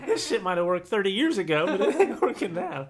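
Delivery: laughing through the words; cracking up while talking. Real, not acted. Laughing hard at own joke.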